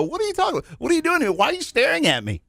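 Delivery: baffled introvert. Retold or imitated. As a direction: act confused and a bit put out. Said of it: "The eyes sit and go".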